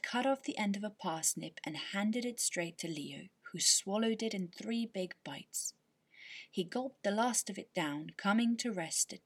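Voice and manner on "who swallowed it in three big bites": usual speaking voice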